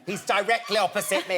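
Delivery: usual speaking voice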